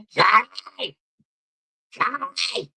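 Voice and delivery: high, far-off calling "Charlie!" like her friend; hammed-up impression of a mate shouting a name; imitating Lincoln. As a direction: mimic the friend's hollering. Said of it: "That's his laugh".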